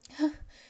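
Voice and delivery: loud high burst of laughter; child bursting out laughing. Acted. Little prince laughs at the pilot, loud high laugh. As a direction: break into a bright laugh. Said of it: "znowu wybuchnął śmiechem".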